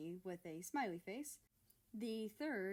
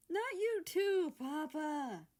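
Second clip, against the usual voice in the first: higher and much louder, slow, exasperated protest; exasperated groan; 10 semitones higher, 9 dB louder, slower than usual. Acted. Germany protesting to his father, a loud exasperated groan. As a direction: whine it out as a teased son who's had enough. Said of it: "Germany groaned".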